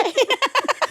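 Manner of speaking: high burst of laughter. Real, not acted. Mother laughing about the nurse, high laugh. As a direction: laugh at your own story.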